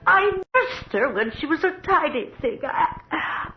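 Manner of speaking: high, tearful plea for her lady; a nurse begging, near tears; pleading nurse. Acted.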